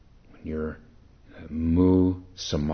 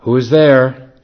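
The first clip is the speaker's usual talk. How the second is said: much higher, louder call; 8 semitones higher, 15 dB louder than usual. Acted. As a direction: call through the door. Voice behind the door asks.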